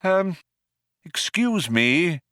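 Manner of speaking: hesitant, slow polite interruption; polite old man. A narrator's reading. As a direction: clear the throat politely. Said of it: "coughed politely".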